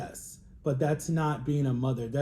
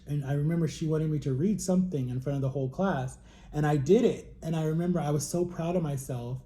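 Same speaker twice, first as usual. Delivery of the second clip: usual speaking voice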